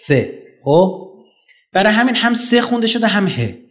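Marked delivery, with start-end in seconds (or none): 0.1-0.4 سه said slowly as Arabic th; Arabic th sound. Shown as a demonstration. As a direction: pronounce سه with the Arabs' three-dot th. («سه» با ث عربی)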